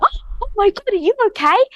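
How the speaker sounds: high-pitched mock panic; alarmed parent. Parent rushing over to a fallen baby, acted alarm. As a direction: imitate a fussing parent, voice shooting way up. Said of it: "If the parent runs over".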